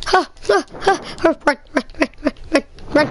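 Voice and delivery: breathless panicked panting; gasping run run run while fleeing; being chased. Pretend.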